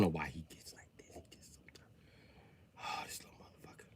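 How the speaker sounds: embarrassed whispered aside. Acted. Comic parent whispering an embarrassed aside. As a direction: mutter it so nobody hears.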